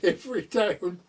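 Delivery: lightly voiced teasing daughter; daughter's voice. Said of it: "she said".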